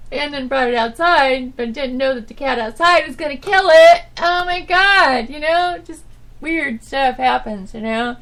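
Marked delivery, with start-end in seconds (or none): none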